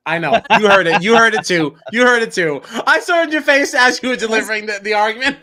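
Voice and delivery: laughing loudly while teasing; high, loud and giggling. Real, not acted. Teasing over the argument.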